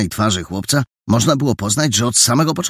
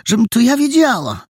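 high, fretful woman's voice; plaintive older housekeeper, pitched high; lamenting landlady; 13 semitones higher than usual. A narrator's reading. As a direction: wail helplessly in a high-pitched voice. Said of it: "uniosła ku niebu swe łagodne oczy".

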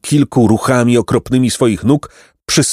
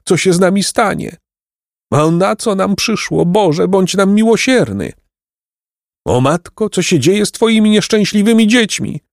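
put-on high-pitched lament of a despairing boy; tearful wailing; 10 semitones higher than usual. A narrator's reading. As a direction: plead and wail, pitched well up. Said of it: "zapłakał Jacek". (wysoki, płaczliwy lament)

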